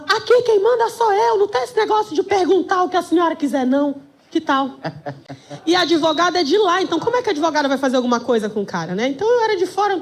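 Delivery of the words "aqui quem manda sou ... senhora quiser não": shouty judge. Retold or imitated. Judge rebuking a woman lawyer, high mock yelling. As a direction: imitate an overbearing judge laying down the law. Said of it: "ele grita em cima de todo mundo".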